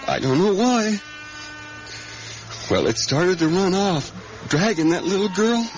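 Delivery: high, strained telling of the horror; anguished outburst. Acted. Earl telling the stranger about the girl's death, anguished. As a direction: relive the terror as it happens.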